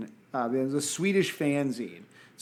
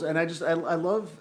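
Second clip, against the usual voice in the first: ordinary talk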